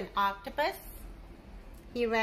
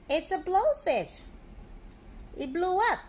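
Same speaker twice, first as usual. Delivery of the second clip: excited; 6 semitones higher than usual. Acted. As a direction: announce the blowfish with a burst of delight. Reading dramatic blowfish reveal.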